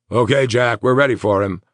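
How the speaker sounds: loud call from the ambulance. A narrator's reading. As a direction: call it across to him. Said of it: "called one of the medics".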